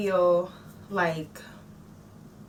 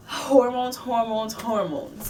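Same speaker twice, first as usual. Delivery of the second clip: louder first hormones, fading over the rest; exasperated repetition; 3 semitones higher, 3 dB louder, slower than usual. Real, not acted. Mom blaming her hormones to viewers, exasperated chant. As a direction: throw up your hands at hormones.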